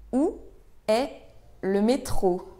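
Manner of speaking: pauses after Où and after est; word by word. Shown as a demonstration. Teacher models asking for the metro, word by word. Teacher breaks question down for learners.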